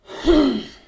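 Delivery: big exasperated sigh; loud sigh. Real, not acted. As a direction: let out a loud, heavy sigh. Excusing self, loud sigh.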